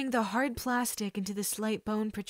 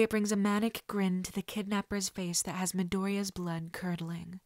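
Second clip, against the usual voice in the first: usual speaking voice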